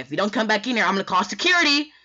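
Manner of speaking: loud, rising high-pitched warning; acted exasperated teacher shouting; teacher's threat. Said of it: "She's like".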